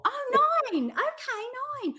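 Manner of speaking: high-pitched mock Essex realisation; Essex accent. Essex customer finally understanding, high mock Essex accent. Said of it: "And then they would go".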